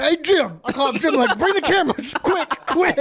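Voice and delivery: high, laughing call to a friend. Retold or imitated. Man calling his friend to bring cameras, laughing. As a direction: call for the cameras while cracking up.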